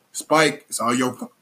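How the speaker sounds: talking through hiccups; words broken by hiccups. Real, not acted. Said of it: "My bad, I got the hiccups".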